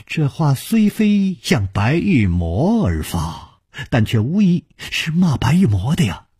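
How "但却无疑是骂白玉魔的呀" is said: plain reading voice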